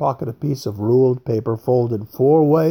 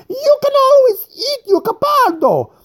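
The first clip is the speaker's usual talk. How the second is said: much higher and louder, fuming; angry fat man; 6 dB louder than usual. A narrator's reading. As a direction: lash out at the rich old man. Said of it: "now became quite angry".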